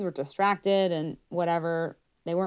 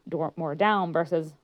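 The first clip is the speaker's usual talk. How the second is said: ordinary talk, nothing marked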